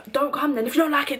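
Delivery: face-to-face shouting match; shouting in his face. Retold or imitated. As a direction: throw it back at him hard. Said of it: "We're shouting in each other's faces".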